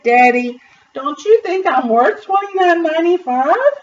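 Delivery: ordinary delivery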